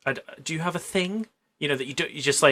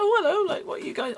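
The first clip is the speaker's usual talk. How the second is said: surprised daughter. Retold or imitated.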